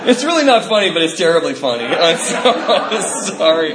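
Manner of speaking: laughing apology, high and breaking up; real helpless laughter. Real, not acted. Comic apologizing for laughing at the dog's death, laughing helplessly. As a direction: try to apologize through the giggles.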